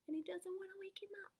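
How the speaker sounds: fast unvoiced whisper. A narrator's reading. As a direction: whisper it quickly so not to wake him. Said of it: "bumblebee asleep in his throat".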